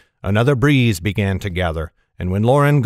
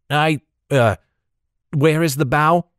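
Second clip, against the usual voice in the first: put-on higher, hesitant boy's voice; confused youngster, pitched up; unsure boy; 5 semitones higher than usual. A narrator's reading. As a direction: ask haltingly, like a lost lad.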